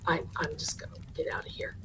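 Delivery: hurried whisper; quick breathy whisper to slip away; whispered exit. Retold or imitated. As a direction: whisper it fast, sneaking out. Scared narrator slipping away.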